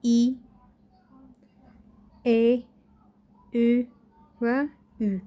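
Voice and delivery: ای، ای، او و او said as separate clear vowels; front vowels listed. Shown as a demonstration. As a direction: sound out ای، ای، او و او one at a time. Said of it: "اینجا اونلو را داشتیم".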